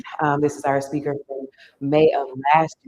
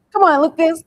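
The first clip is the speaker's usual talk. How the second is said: calling out; 12 semitones higher, 7 dB louder than usual. Real, not acted. Host urging a presenter up.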